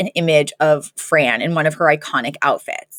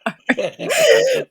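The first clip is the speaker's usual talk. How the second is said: laughing; 9 semitones higher, slower than usual. Real, not acted. Laughing while telling.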